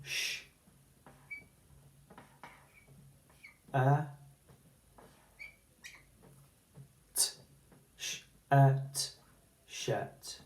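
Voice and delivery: shirt built sound by sound, fading to a soft shirt. Shown as a demonstration. Teacher sounding out shirt for the class. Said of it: "using its sounds".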